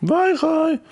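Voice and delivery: very high-pitched mock taunt; mocking jeer. Co-host heckles the musician's confession, high mocking jeer. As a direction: squeal the insult in a put-on high voice. Teasing heckle at bandmate's story.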